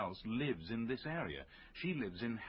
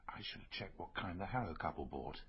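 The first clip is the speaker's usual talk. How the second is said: whispered to himself; 6 semitones lower, 5 dB quieter than usual. Real, not acted. Muttering self-reproach to himself.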